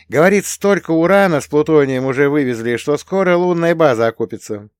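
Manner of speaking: put-on young woman Rita. A narrator's reading. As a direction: Rita chattering brightly, a lifted young woman's voice.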